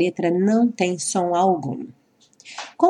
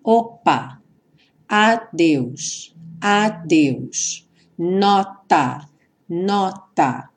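"opa", "adeus", "nota" said slowly, each stop cut short; slow demo words; much slower than usual. Shown as a demonstration. Teacher slowing down Portuguese words to show stops, deliberate. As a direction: draw each word out so the stop consonant is heard breaking the sound. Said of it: "falar lentamente as palavras".

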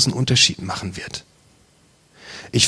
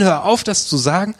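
mock yell; 7 semitones higher than usual. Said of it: "Ich will brüllen".

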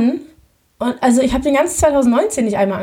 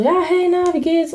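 bright, much higher phone hello; breezy hello to an ex, pitched way up; cheerful greeting; 6 semitones higher than usual. Retold or imitated. (fröhlich hoch begrüßen)

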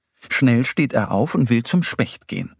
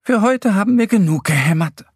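high, louder, cheerful call; cheery announcement; 10 semitones higher, 5 dB louder, faster than usual. A narrator's reading. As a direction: declare the work done in a raised voice. Two friends call out finish.

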